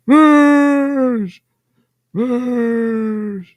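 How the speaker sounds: long, high, loud vengeful yell; hammed-up scream, stretching the name; mock Captain Kirk scream. Imitating a man screaming at his nemesis, mock vengeful scream. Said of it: "Captain Kirk screaming at Khan".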